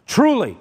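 "Truly" pitched high and loud; "Truly" as a forceful declaration; emphatic. Retold or imitated. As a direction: hit "Truly" with full force. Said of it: "making such an emphatic point".

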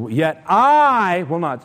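slow, insistent weight on "I"; emphatic. Retold or imitated. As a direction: stress on "I", stretched long and loud. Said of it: "It's emphatic just like that".

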